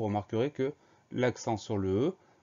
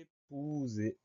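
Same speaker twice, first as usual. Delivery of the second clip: EPOUSER drawn out very slowly, higher and softer; syllable by syllable; 4 semitones higher, 7 dB quieter, much slower than usual. Shown as a demonstration. Teacher dictates the next word to pupils, slowly stretched syllables. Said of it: "Première syllabe".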